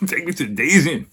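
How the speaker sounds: high, pushy plea to the driver; urgent drunk demand. Retold or imitated. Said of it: "repeated demand to driver".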